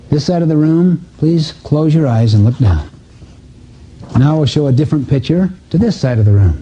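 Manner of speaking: usual speaking voice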